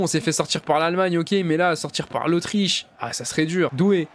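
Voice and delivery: usual speaking voice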